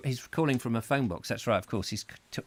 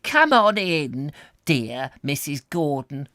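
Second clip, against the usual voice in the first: high, slow, drawn-out greeting; elderly Welsh hostess, welcoming; 5 semitones higher, 7 dB louder, slower than usual. Acted. Miss Lewis greets Missus Gordon, effusive Welsh hostess. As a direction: usher them in, stretching the words.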